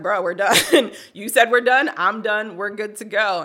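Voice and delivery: loud, high, laughing retort; laughing mock retort. Telling the HR woman she's done, loud laughing retort. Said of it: "Yeah, I was like".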